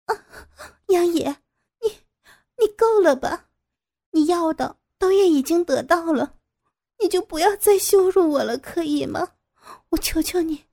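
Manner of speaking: tearful pleading. Acted. Captive begs her captor to release her, tearful pleading.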